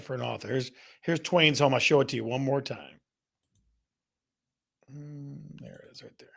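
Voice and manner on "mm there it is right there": hushed murmur. Real, not acted. Host leaning in, hushing for viewers. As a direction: drop to a near whisper.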